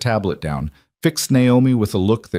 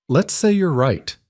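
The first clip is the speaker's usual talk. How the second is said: careful; 6 semitones higher than usual. A narrator's reading. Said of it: "Naomi said carefully".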